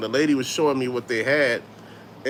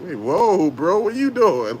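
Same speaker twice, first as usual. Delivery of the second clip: retold surprise; 4 semitones higher, 4 dB louder, slower than usual. Retelling people's reaction to mispronunciation.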